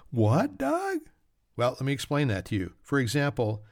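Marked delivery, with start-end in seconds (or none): 0.1-1.0 high-pitched mock disbelief, rising sharply; puzzled listener. Podcaster voicing the listener's confusion, high puzzled voice. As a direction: squeak it like a baffled audience member. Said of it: "You're saying".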